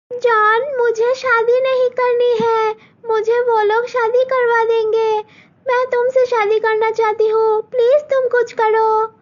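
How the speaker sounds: put-on high, crying young girl begging; tearful pleading. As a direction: plead through tears for help. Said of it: "मालती रोते रोते".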